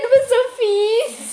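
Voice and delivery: high, drawn-out squeal through laughter; laughing protest. Real, not acted. To her friend after the noise prank, squealing with laughter. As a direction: protest loudly and high, still cracking up.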